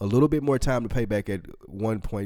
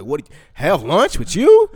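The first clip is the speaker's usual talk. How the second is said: high, loud, rising disbelief on the question; mock incredulous; 5 semitones higher, 6 dB louder, slower than usual. Reed reacting to a lunch invitation, incredulous. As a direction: act out baffled disbelief, voice shooting up. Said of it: "looked at him like he had six foreheads".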